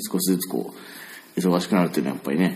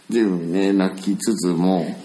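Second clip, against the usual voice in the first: ordinary delivery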